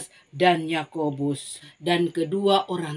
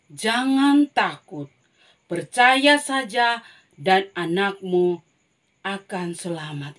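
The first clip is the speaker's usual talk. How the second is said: slow, deliberate comfort; slow and firm, calming a frightened father; reassuring Jesus; 5 dB louder, slower than usual. Acted. Jesus calms the frightened Jairus slowly. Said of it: "Tuhan Yesus berkata".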